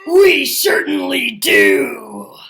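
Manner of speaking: alligator voice. Acted. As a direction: loom up and boom it out slowly. Alligator pops up behind counter.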